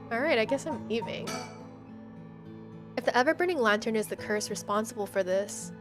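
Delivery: ordinary talk, nothing marked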